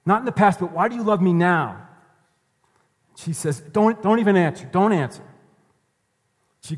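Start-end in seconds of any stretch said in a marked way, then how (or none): 0.0-1.8 raised, louder voice, angrily demanding; angry, pointed. Retold or imitated. Wife confronting her husband about his love, angry and pointed. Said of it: "asks the husband angrily, pointedly". 3.6-5.2 high, loud, cutting him off; angry, pointed. Retold or imitated. Wife refusing her husband's answer, angry and pointed. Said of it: "asks the husband angrily, pointedly".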